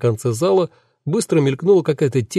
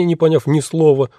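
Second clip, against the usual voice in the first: ordinary delivery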